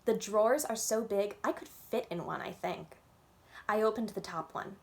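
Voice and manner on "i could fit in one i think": usual speaking voice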